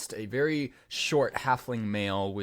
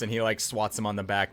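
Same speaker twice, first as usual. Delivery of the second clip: ordinary talk, nothing marked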